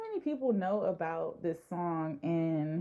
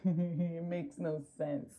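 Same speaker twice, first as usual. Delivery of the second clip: laughing; slower than usual. Real, not acted. Laughing at herself.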